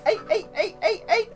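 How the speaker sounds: high "eight" for every vowel, light Cockney hint; mock Cockney vowels. Shown as a demonstration. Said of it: "That's how they pronounce A".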